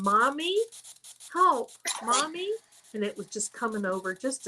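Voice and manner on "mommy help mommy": put-on a mild child's plea, higher and slower on help; little voice calling for help. Retelling the voice heard on the Facebook Live, a mild imitated child's plea. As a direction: lightly voice a small child calling Mommy for help. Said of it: "a little voice that kept saying".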